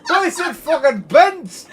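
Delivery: loud, high-pitched outburst; exasperated shout. Real, not acted. Streamer furious at the bent metro line, exasperated shouting. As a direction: shout it in comic outrage.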